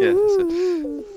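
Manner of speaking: high squeaky laugh through the words. Real, not acted. Laughing at a friend's creepy song. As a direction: giggle it out, drawn out and high.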